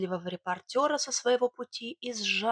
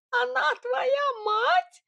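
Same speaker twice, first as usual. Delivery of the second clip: very high, drawn-out shriek; squeaky, scandalised Sidor voice; wailing out; 4 dB louder, much slower than usual. Acted. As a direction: squeal the discovery slowly. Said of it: "возопил Сидор".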